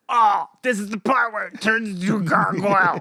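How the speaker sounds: loud, high-pitched thrilled recognition; excited outburst. Retold or imitated. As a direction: blurt it out like an overexcited fan. Re-enacts excited recognition while watching.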